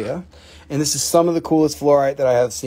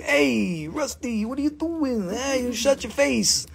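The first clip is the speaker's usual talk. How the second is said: high-pitched teasing scold; playful put-on nagging, pitched high; imitated mockery; 8 semitones higher than usual. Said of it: "you're gonna make fun of me".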